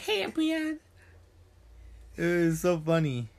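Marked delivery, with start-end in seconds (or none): 0.0-0.8 very high, squeaky greeting; high squeaky kid voice. Retold or imitated. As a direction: squeak it out like a young gamer. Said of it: "He was like".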